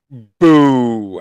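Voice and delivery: loud mock boo; booing winter. Host boos the coming winter loudly. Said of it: "I say to winter".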